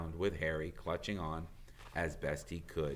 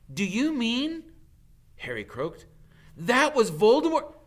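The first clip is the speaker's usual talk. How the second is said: loud, much higher panicked cry; frightened outburst; 11 dB louder, slower than usual. Acted. Harry realising it was Voldemort, loud and high. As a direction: blurt it out in shock. Harry croaked, Hermione shouting.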